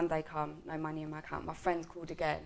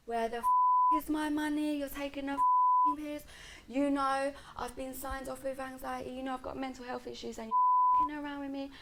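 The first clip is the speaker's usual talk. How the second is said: higher-pitched re-enacted swearing tirade; indignant South London telling-off, replayed without shouting; 6 semitones higher than usual. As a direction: act out going ballistic on the phone.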